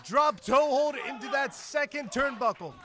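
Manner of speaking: excited, loud ringside play-by-play; wrestling commentator. Acted. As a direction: call the action as it happens.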